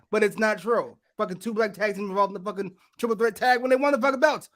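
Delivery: ordinary delivery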